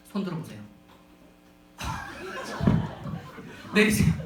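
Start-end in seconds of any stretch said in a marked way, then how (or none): 1.8-4.1 giggling through the instruction; laughing. Real, not acted. Laughing while telling hands down.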